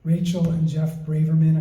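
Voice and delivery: usual speaking voice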